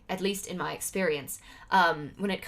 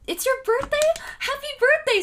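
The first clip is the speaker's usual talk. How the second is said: excited; 5 dB louder than usual. Real, not acted. Viewer says it's their birthday.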